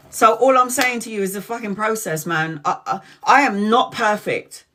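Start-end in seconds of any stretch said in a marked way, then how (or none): none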